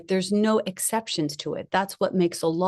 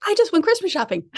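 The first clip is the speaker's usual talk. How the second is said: amazed; 10 semitones higher, faster than usual. Retold or imitated. Retelling amazed discovery.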